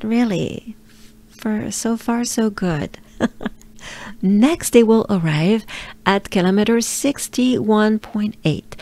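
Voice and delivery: ordinary delivery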